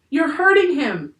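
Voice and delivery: high, loud protest; distressed yell. A narrator's reading. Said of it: "yelled Annie".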